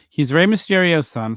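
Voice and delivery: usual speaking voice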